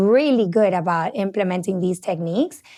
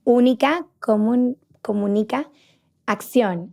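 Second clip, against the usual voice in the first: word parts pronounced in Spanish; Spanish pronunciation. Shown as a demonstration. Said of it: "In Spanish".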